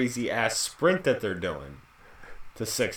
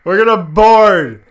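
higher, yelling announcement; over the intercom; 10 semitones higher, 13 dB louder than usual. Pretend. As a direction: bellow it over the PA.

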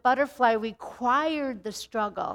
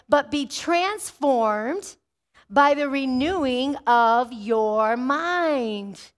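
drawn-out, weighty reading of scripture; slow emphatic verse; much slower than usual. Acted. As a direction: lean on every word, slowly. Preacher stressing key verse.

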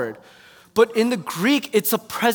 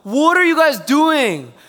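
higher, louder, very slow disbelief; exasperated yell; 7 semitones higher, 7 dB louder, much slower than usual. Retold or imitated. Finding the groomsmen asleep, exasperated shout. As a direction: stretch it out in outrage. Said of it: "I'm like".

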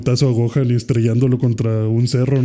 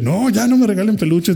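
Piccolo yelling; 9 semitones higher than usual. Retold or imitated. Piccolo refusing more plush toys, mock yelling. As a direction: imitate an exasperated outburst, pitch shooting up. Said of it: "le empieza a gritar".

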